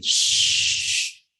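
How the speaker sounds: long unvoiced shushing like wind; wind effect hiss. Preacher imitating the wind effect on the cassette, long hiss. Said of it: "Ayatnya cuman efek angin".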